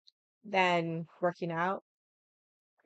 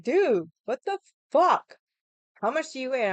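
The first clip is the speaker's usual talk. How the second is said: mock the friend's stunned dude; shocked friend; 5 semitones higher, 7 dB louder than usual. Friend reacting to her weight loss, hammed-up higher and louder shock.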